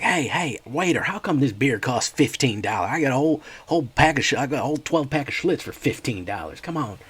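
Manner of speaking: a beer-drinking Southern guy outraged at the bill; indignant Alabama customer. Retold or imitated. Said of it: "He's like".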